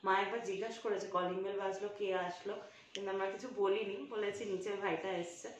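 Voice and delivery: conspiratorial hush, planning a surprise; hushed secret talk. Real, not acted. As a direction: keep it very soft so mom can't hear. Hiding surprise gift from mother. (মায়ের কাছে লুকিয়ে, খুব নিচু গলায় ফিসফিসে কথা)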